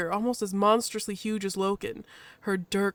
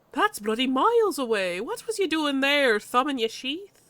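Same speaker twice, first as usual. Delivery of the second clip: higher, lively Irish-tinged jibe; vaguely Irish accent; 5 semitones higher than usual. Acted. Badger ribs Loken, vaguely Irish. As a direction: scold teasingly in a loose Irish accent. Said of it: "the badger's vaguely Irish".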